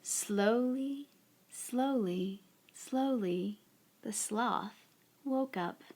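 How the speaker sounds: slow, soft storybook narration; unhurried picture-book reading, softly spoken; story read to children. A narrator's reading. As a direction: draw out each phrase gently, leaving long pauses.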